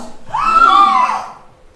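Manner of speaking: huge high shrieking gasp; loud theatrical gasp; dramatic gasp. Acted. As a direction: gasp as if the twist floors you.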